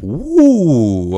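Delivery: high, loud drawn-out "ooh"; rising whoop of mock alarm; mock alarmed reaction. Acts out reaction to a threat.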